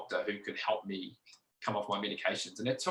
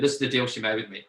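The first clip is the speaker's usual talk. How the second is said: ordinary talk, nothing marked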